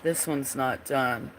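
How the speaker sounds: normal talking voice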